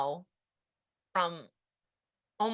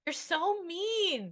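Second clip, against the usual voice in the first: gentle scolding; 10 semitones higher, much slower than usual. Retold or imitated. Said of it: "and I'm going".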